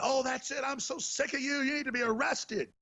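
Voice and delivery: high-pitched mock exasperation; mimicked outrage. Person confronting host to his face, mock-exasperated. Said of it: "And they went".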